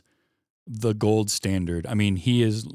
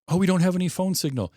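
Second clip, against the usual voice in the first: mocking whiny; 10 semitones higher, 3 dB louder, faster than usual. Mocking dumb horror character.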